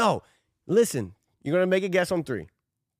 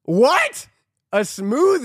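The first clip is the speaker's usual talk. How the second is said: louder, higher disbelieving what; surprised what; 5 semitones higher, 6 dB louder, much slower than usual. Real, not acted. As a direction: react to the absurd answer. Friend gives an absurd answer.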